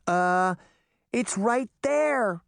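slow, high, uneasy pointing out; alarmed cry. A narrator's reading. Daphne warns the others, uneasy and slow. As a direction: stammer it out, staring back at the monster. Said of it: "Daphne cried".

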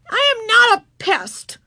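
high, loud, slow indignant protest. A narrator's reading. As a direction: insist hotly. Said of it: "said Ramona indignantly".

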